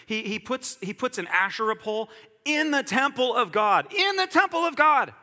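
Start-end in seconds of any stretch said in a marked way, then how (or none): 3.9-5.1 much higher and louder, incredulous; outraged disbelief, almost shouted; outraged exclamation. Real, not acted.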